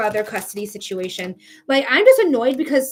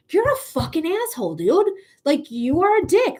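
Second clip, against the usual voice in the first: higher, mocking contempt for him; scornful insult; 5 semitones higher than usual. Reader channels the client insulting her ex, scornful. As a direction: throw the insult right at him.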